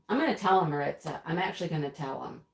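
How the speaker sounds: ordinary talk, nothing marked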